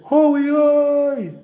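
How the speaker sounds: very high, loud, long-held holler; sing-out hail, stretched on one high note; long-distance call. Shown as a demonstration. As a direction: sustain the call so it carries far. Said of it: "we a call somebody".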